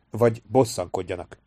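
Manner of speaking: ordinary delivery, nothing marked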